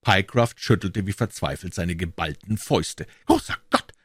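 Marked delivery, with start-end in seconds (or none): 3.3-3.9 much higher, louder cry of Großer Gott; shocked exclamation. A narrator's reading. The client realizes he was fooled, crying out higher and louder. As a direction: burst out in sudden realization. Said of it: "rief er".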